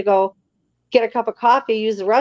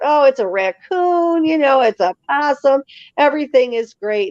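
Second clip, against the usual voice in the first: dismissive reassurance; 6 semitones higher than usual. Retold or imitated. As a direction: wave away his fear like it's nothing. Brushing off the frightened cable guy.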